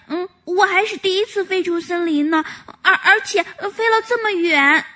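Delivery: put-on lightly high childlike woodpecker; flustered little woodpecker. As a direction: stumble over excuses like a shy young bird. Woodpecker voiced.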